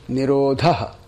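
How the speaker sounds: "nirodha" with an aspirated dh, falling; staccato. Shown as a demonstration. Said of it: "So one more time".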